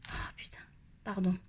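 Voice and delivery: hushed curse under breath; whispered swear, then sorry; whispering. Real, not acted. Swears quietly, apologizes.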